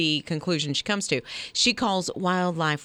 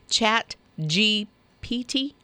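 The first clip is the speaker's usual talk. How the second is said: "Chat G P T" slowly, each letter separate, questioning; careful, slow; slower than usual. Shown as a demonstration. Co-host tries the new term, "Chat G P T" slow and tentative. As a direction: try "Chat G P T" one letter at a time. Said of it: "say that slower so I don't say it wrong".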